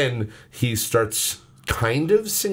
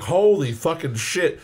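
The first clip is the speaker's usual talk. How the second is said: amazed; 7 semitones higher, slower than usual. Retold or imitated. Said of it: "And I was like".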